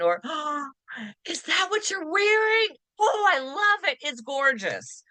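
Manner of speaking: delighted gasp. Shown as a demonstration. Friend admiring an outfit, gushing and delighted. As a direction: gasp and gush over the outfit.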